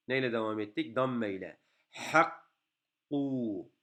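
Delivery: ordinary delivery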